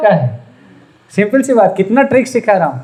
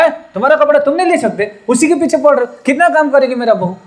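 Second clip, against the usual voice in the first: higher, fast, indignant telling-off; scolding; 7 semitones higher, faster than usual. Retold or imitated. Mother-in-law scolding younger son for pestering the bride, fast and indignant. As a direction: snap at the lazy son in defence of the bride.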